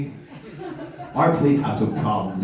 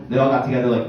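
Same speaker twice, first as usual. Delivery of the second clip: ordinary talk, nothing marked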